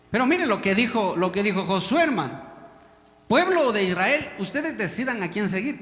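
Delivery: loud, high preacher's proclamation; fervent declaration from the pulpit; preaching proclamation. Acted. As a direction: announce it to the whole people.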